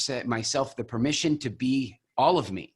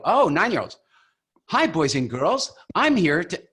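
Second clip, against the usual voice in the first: put-on higher, louder, bouncy kid-show voice; talking to nine-year-olds; 5 semitones higher, 4 dB louder than usual. Shown as a demonstration. Coach demoing a speaker who wakes up for children. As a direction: greet a room of kids with big energy. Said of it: "act like the audience is all nine-year-olds".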